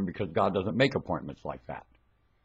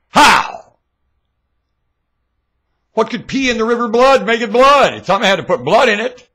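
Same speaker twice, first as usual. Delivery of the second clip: high, loud questions flung out; frenzied preaching; 10 semitones higher, 11 dB louder than usual. Real, not acted. Preacher demanding answers from his crowd, frenzied preaching. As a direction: press the listeners hard.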